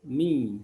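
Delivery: "Mi" with lips spread in a grin; spread kasrah vowel. Shown as a demonstration. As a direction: grin through a clear I in "Mi".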